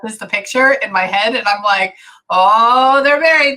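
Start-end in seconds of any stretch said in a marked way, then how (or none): 2.3-3.6 loud, rising cry of discovery; acted-out big, drawn-out 'aha' moment; sudden realization. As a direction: let it climb high as it dawns on you. Acts out her sudden realization.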